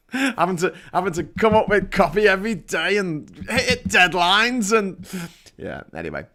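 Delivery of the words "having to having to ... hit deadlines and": high, hammed-up Yorkshire grumbling; Yorkshire playwright dad. Playwright dad complaining about copy and deadlines, mock Yorkshire indignation. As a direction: imitate a Yorkshire dad moaning about his toil. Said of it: "this Yorkshire dad who's a playwright".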